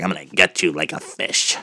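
mock menacing villain threat; put-on creepy threat; creepy caller. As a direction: lightly imitate a sinister phone stalker. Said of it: "kinda seductive but kinda creepy voice".

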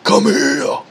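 high mock battle cry at a weight; imitated yell. Mimicking lifter yelling to the weight, high yell. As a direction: holler it like an overhyped lifter. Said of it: "yelling at the weight".

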